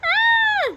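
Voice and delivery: very high, loud scream; high startled shriek. A narrator's reading. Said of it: "she screamed again".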